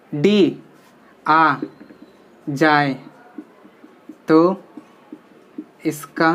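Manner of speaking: each word spaced out with long pauses, as if writing on the board; slow dictation pace. Shown as a demonstration. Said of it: "यहाँ पे लिख देते हैं short summary में". (Board पर लिखते हुए धीमी, रुक-रुक कर बोली गई बात)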